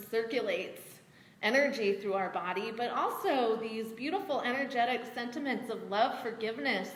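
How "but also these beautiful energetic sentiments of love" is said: ordinary talk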